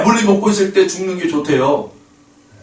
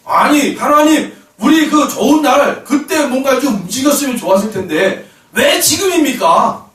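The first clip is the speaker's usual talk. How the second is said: acted-out high-pitched grievance; complaining to God; 4 semitones higher than usual. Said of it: "이렇게 원망의 소리가 나올 때가 있어요".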